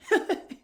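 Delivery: high cackling laugh; high burst of laughter. Real, not acted. Speaker cracking up at the postman's line, high laugh. Laughing at his own story.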